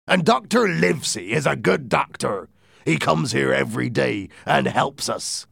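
persuasive pirate captain. Acted. Silver winning over his men, emphatic.

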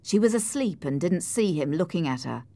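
usual speaking voice

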